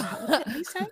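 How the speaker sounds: laughing disbelief. Retold or imitated. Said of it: "I was like".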